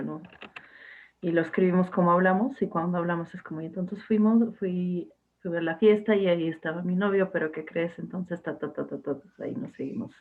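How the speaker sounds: ordinary delivery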